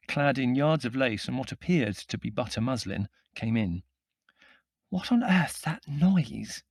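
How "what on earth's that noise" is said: high, slow, hoarse stage whisper; hoarse accusing whisper. A narrator's reading. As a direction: accuse in a drawn-out hushed hiss. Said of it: "she asked in a hoarse, accusing whisper".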